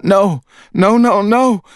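a wife breaking down at the door; distraught wife. A narrator's reading. Wife refuses the officers, high and distraught. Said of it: "shaking her head".

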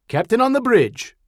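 high, loud formal call; formal announcement. A narrator's reading. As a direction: announce it to the whole bridge. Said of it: "Chief Kuznetsov announced".

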